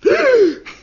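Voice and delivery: sharp high gasp of shock; startled gasp. Acted. Husband gasps seeing wife's mud mask, startled. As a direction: gasp at the sight of her.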